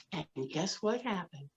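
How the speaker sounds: usual speaking voice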